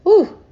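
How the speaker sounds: high loud ooh as feeling washes over; emotional gasp. Real, not acted. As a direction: let a sudden ooh burst out. Sudden wave of emotion.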